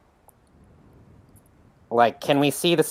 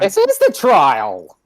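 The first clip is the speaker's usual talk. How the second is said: indignant shout; 6 semitones higher, 7 dB louder, slower than usual. Acted. Mock outrage at table.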